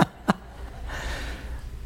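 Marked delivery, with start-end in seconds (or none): 0.0-0.1 high, breathy laughing through the words; giggling at the volunteers. Real, not acted. Preacher laughing at volunteers pushing each other over.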